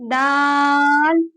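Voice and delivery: دال with the long a held very long; stretched daal. Shown as a demonstration. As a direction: draw out دال for five counts. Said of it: "اس کو بھی پانچ الف کھینچیں گے".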